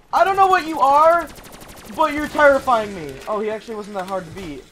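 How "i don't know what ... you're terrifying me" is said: scared. Real, not acted. Fighting an unknown monster.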